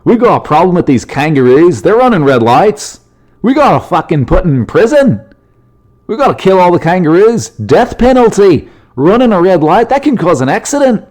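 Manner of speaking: high, loud mock outrage; kangaroo-hating rant. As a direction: rant like an overwrought alarmist, hammed up. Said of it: "sounds like he hates kangaroos".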